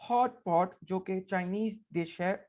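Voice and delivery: ordinary talk, nothing marked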